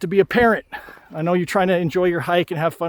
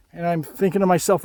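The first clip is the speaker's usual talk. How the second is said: ordinary talk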